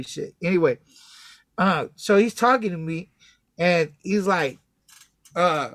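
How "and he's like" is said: ordinary talk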